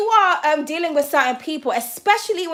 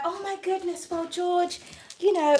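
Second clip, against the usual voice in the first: lightly mocking a gentle, soothing reader; mock sweet reader; 3 semitones higher, 4 dB quieter than usual. Tarot reader mocking softer readers talking to George, sugary mock sympathy. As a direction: put on a soft, too-nice reader voice, a little higher. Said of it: "one of those readers that's gonna be like".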